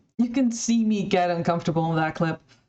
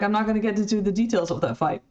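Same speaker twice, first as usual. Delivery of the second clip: quick dismissive quote; faster than usual. Retold or imitated. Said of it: "she's like".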